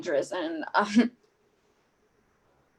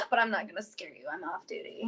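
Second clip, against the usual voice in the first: softer, gentle reassurance; hushed and kind; reassuring; 6 semitones lower, 9 dB quieter, much faster than usual. Acted. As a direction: soothe the child, dropping away. Reassuring the scared child.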